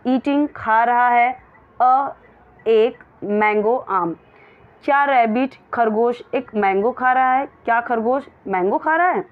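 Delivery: normal talking voice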